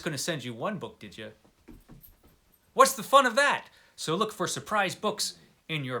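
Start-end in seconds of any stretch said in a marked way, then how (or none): 2.7-3.7 bright rising tease, stress on fun; delighted rhetorical question; playful. Real, not acted. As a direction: tease them about the extra books. Playful teasing about surprise books.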